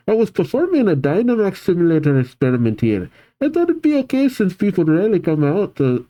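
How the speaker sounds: tirade. Acted. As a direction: rattle off the excuse without stopping. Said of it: "goes on a tirade".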